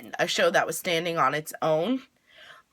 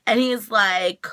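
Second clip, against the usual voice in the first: normal talking voice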